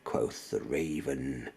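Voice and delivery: ominous low murmur; low, hushed. A narrator's reading. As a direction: sink into a dark hush. Raven's final reply.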